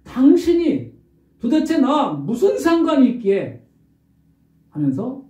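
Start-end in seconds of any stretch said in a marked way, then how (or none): 0.0-3.6 loud, high accusing cry; angry protest. Acted. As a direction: confront the prophet in grief. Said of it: "따집니다". (하나님의 사람에게 따지는 여인, 높고 큰 항의)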